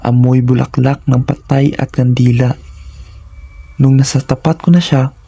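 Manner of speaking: ordinary delivery, nothing marked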